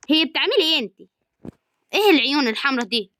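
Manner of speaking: playfully mock Egyptian, a bit high; Egyptian accent. Gamer mocking her avatar's look in Egyptian accent. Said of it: "نتكلم باللهجة المصرية".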